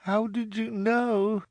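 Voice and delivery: tearful. A narrator's reading. Widow weeping over husband's death.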